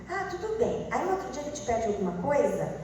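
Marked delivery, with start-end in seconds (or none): 0.1-0.9 higher light "tudo bem"; breezy, easy yes; sweet agreeing mom. Retold or imitated. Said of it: "Você vai falar assim". (mãe concordando leve e aguda)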